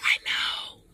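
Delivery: hushed answer; whispered reply. Retold or imitated. Oprah answering the speaker, hushed whisper. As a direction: whisper it back knowingly. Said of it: "She's like".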